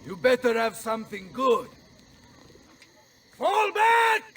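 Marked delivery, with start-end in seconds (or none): 3.4-4.2 high, loud command to retreat; shouted order. Acted. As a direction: call the retreat across the field.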